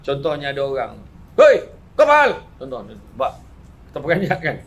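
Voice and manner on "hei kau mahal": high, loud yell of alarm; shouted outburst. Retold or imitated. Startled stranger yelling at someone nearby, loudly. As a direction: bark it out startled.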